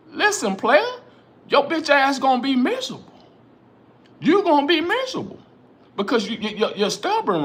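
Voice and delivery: heated, high-pitched warning to stubborn parents; fired-up callout. Real, not acted. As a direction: rise up high and call the stubborn player out.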